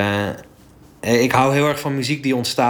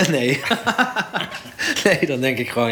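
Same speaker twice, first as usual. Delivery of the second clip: laughing; 6 semitones higher than usual. Real, not acted. Laughing at own joke.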